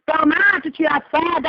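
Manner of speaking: normal talking voice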